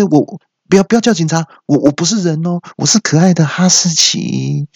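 high, comic panicky protest; hurried pleading. Acted. Fake husky begging bystanders not to call police, pleading. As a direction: plead innocence, stretching the last words. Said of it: "我之后就赶快说".

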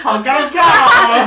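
loud, high embarrassed laugh; nervous laugh. Real, not acted. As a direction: laugh off the awkward moment.

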